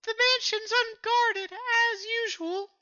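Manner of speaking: very high-pitched Southern belle. Acted. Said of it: "Nami became Southern".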